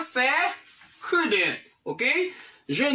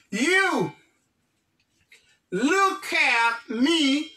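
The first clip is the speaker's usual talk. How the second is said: drawn out, very slow; slow command; 3 semitones higher, much slower than usual. Real, not acted. Teacher drawing out an order for the class to look. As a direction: stretch every word so they watch. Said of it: "commanding class attention".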